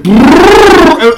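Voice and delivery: long, loud, high rolled engine roar; imitated motorcycle engine. Friend imitating a modified car pulling away, roaring brrrr. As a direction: blast out a drawn-out revving noise. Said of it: "It sounds like a motorcycle".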